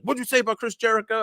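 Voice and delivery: rapid-fire indignant question, retold; picking a fight. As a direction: imitate a wrestler spoiling for a fight. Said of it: "he wanted to fight somebody".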